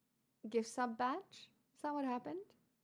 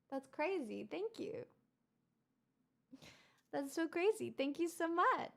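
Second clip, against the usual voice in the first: ordinary talk, nothing marked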